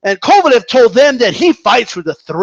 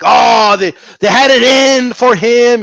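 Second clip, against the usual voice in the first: slower, louder wail of grievance; hammed-up conspiracy complaint; mock outrage; 3 semitones higher, 4 dB louder, slower than usual. Vlogger mimics fans claiming a conspiracy, mock outrage.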